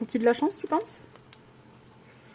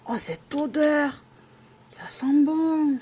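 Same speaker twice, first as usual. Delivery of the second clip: high, drawn-out swoon of delight at a smell; delighted savouring; 6 semitones higher, 4 dB louder, much slower than usual. Real, not acted. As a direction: revel in it, stretching the words out high. (ravissement gourmand, mots étirés)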